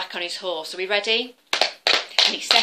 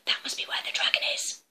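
hushed fast whisper; 4 dB quieter, faster than usual. Acted. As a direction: whisper so the dragon won't hear. Hero whispering near cave.

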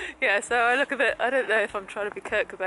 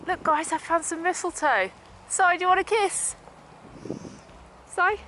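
playful; 11 semitones higher than usual. Real, not acted. Teasing partner with mistletoe.

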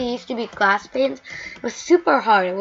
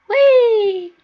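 very high, loud cry; gleeful whee; 12 semitones higher, 6 dB louder than usual. Real, not acted. Gamer sliding down, high loud whee. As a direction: squeal with the fall.

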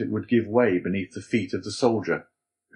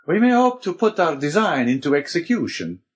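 higher-pitched Abbé character voice; Abbé, resolute; 8 semitones higher, 4 dB louder than usual. A narrator's reading. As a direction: announce it with lifted pitch. Abbé agrees to the plan.

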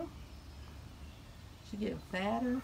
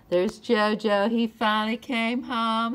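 calling out; 11 dB louder than usual. Real, not acted. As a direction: call out across the yard to the cat. Cat comes home, calls out.

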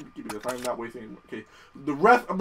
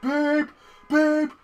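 high, monotone honking car voice; annoying car impression; 11 semitones higher, much slower than usual. Mocking a car outside, high honking car voice. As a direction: honk the words like a car horn. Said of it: "There's a car outside going".

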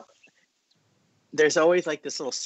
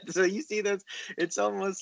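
high laugh running through the words; 10 semitones higher than usual. Real, not acted. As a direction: chuckle as you point it out. Laughing while explaining the drawing.

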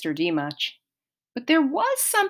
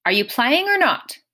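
testy question, pitched up; higher, impatient prodding; 6 semitones higher than usual. A narrator's reading. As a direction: demand an answer impatiently. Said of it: "Mister D said impatiently".

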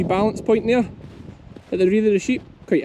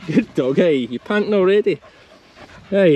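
ordinary talk, nothing marked

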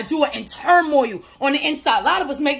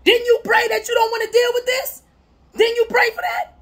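sudden realisation; 8 semitones higher than usual. Retold or imitated. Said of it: "Not knowing".

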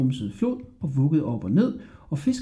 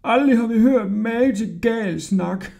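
mocking porter; 8 semitones higher than usual. A narrator's reading. Drunk porters mocking boy.

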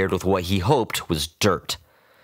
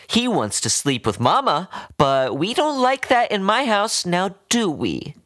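put-on doting old woman; 12 semitones higher than usual. A narrator's reading. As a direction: coo and scold like a doting owner.

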